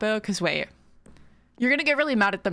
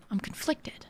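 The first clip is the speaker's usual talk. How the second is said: whispered, much softer repeat; 8 dB quieter than usual. Real, not acted. Streamer repeats her indecision in a whisper to herself. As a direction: whisper it again to yourself.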